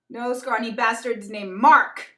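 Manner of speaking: slow, loud proclamation; triumphant reading. Retold or imitated. Showing the revenge sign, slow and loud. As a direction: read it out like a victory banner.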